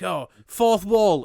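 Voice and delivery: mock realisation. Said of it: "They were like".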